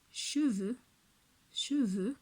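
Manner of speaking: "cheveux" said twice, syllables clear; syllable demo. Shown as a demonstration. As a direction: model "cheveux" for children to echo. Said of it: "Pour deux syllabes".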